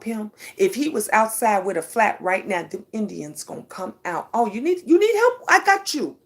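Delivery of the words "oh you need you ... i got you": higher, eager offer of help; eager helper. Retold or imitated. Passersby offering to fix her son's flat, eager and higher. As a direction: jump in to help, pitched up. Indians helping son with flat tire.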